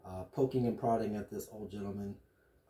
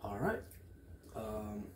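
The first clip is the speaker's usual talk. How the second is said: ordinary talk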